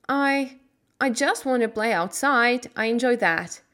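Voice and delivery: put-on a girl nervous before her uncle; timid Mary.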